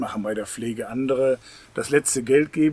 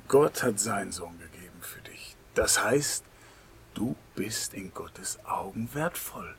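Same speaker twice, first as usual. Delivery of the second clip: intimate whisper; 4 semitones lower, 5 dB quieter than usual. Real, not acted. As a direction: lean in and whisper the promise. Intimate emphasis in sermon.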